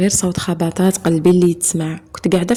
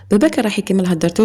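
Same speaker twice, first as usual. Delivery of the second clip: ordinary delivery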